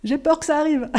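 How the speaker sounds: high, pleading, afraid it will happen; fearful pleading prayer. Retold or imitated. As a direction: beg the Lord in a mock-frightened voice. Retold fearful prayer to God.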